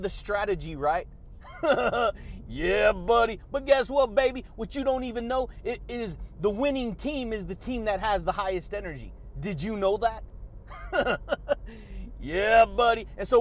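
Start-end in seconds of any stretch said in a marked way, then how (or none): 1.1-2.4 a crying-edged wheeze of a laugh; goofy laugh. Real, not acted. Signature laugh after a point. 10.3-12.2 a long, falling giggle; goofy laugh. Real, not acted. Signature laugh after a question.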